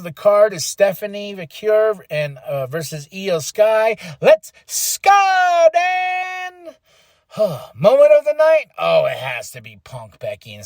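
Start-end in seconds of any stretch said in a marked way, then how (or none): 4.2-6.7 hyped shout. Real, not acted. Fan cheering a match announcement, hyped shouting. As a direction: belt it out high and long.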